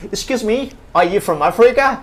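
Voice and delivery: high-pitched retold tourist question. Foreign woman asks a hotel stranger, retold high-pitched question. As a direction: imitate her curious question, voice lifted.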